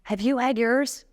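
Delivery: put-on a small boy proudly asking his mom; sweet little boy asking. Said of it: "He's like".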